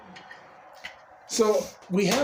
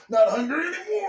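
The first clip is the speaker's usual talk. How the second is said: Alf voice; 10 semitones higher than usual. Retold or imitated. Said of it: "And Alf would be like".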